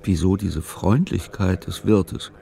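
ordinary delivery

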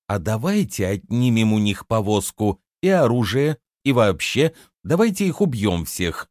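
ordinary delivery

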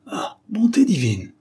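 high-pitched cry of dismay; shocked exclamation. A narrator's reading. Man who just read the farewell note, crying out. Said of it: "s'écria le pauvre homme".

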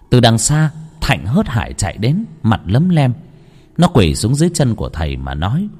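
usual speaking voice

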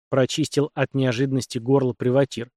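ordinary delivery, nothing marked

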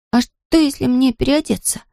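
tentative, pondering question; musing mutter. A narrator's reading. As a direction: wonder aloud as an idea dawns. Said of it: "пробормотал он".

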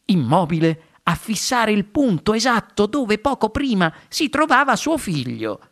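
usual speaking voice

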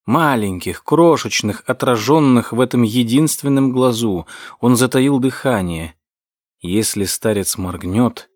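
usual speaking voice

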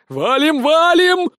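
high, rising frantic yell; panicked shout. Acted. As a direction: scream to run in panic. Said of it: "панически закричал Сисюля". (паническим криком)

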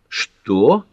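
rising, incredulous one-word question; startled exclamation. A narrator's reading. Pat reacting to Ellery's answer, startled exclamation. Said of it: "воскликнула Пэт".

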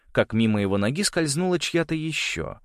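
ordinary delivery

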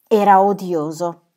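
loud, slow snap of disgust; angry outburst. Acted. Gertrude venting to her friends about the man, loudly and angrily. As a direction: blurt it out, fed up. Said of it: "sbottò Gertrude".

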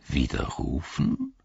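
„Widerrufen?“ leise, langsam und fragend steigend; befremdet. A narrator's reading. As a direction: als wäre das Wort ihm fremd. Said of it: "als kenne er das Wort nicht". („Widerrufen?“ verständnislos nachgefragt)

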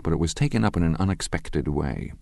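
plain reading voice

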